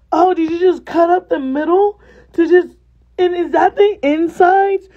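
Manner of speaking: high, loud shocked question; retold freak out. To the waitress about hairy lobster, high shocked outcry. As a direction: act out a hammed-up horrified discovery. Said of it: "I just had a complete freak out".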